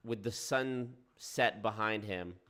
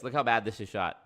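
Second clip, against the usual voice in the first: usual speaking voice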